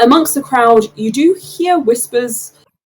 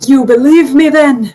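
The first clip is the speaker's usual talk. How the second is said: hopeful halfling; 5 semitones higher, 5 dB louder than usual. Acted. Halfling NPC voiced by GM.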